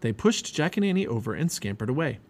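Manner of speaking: ordinary delivery, nothing marked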